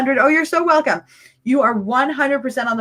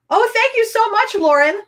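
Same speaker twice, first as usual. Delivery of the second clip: bursting with excitement, much higher; excited; 7 semitones higher than usual. Real, not acted. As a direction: gush thanks to Lauren, pitched way up. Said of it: "I'm just so excited".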